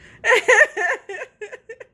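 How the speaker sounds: high, loud burst of laughter. Real, not acted. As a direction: break into laughter at the memory. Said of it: "I'm laughing because".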